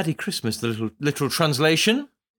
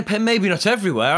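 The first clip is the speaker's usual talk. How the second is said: ordinary talk, nothing marked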